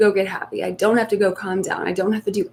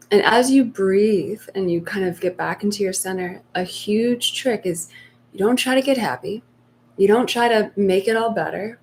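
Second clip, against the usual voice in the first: ordinary delivery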